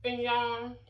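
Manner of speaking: talking through food; mouth full. Real, not acted. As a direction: say it round a bite. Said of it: "excuse me for talking. Food in my mouth".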